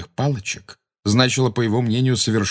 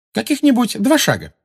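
high-pitched cry back; calling out; 13 semitones higher, 4 dB louder than usual. A narrator's reading. Hermione promises Umbridge it is near, calling out. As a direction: call it out in a high voice. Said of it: "крикнула Гермиона".

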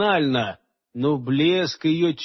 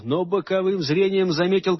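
usual speaking voice

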